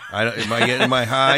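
confused outburst. Retold or imitated. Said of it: "you're like".

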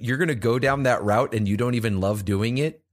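ordinary delivery

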